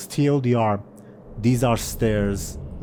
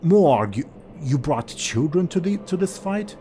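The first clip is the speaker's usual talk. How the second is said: worried father; 7 semitones higher, 4 dB louder than usual. Acted. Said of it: "your father will say".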